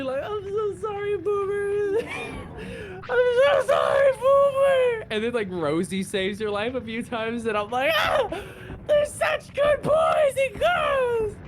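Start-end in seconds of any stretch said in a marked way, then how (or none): 0.2-5.1 very high wailing, building to a shout; mock sobbing apology. Mock sobbing apology to game dog. 8.0-11.4 very high, loud, drawn-out lament; hammed-up wail. Wailing over loyal game dogs.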